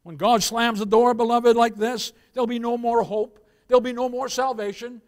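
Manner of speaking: loud, high, insistent warning; full-force hellfire preaching. Real, not acted. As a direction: hammer the repeated phrases from the pulpit.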